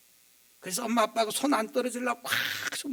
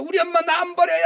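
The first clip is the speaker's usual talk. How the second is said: put-on crying child; 10 semitones higher, 13 dB louder than usual. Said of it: "애는 막 울면서".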